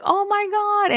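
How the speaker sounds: sudden high gasp of oh my god; stunned surprise. Retold or imitated. Bride walking in and seeing the proposal, high stunned cry. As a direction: let the shock burst out high. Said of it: "I was like".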